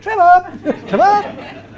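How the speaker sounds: high, loud mock call; hammed-up dog-walker's call. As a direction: holler for a dog across a park, amused. Imagines calling dog on a walk.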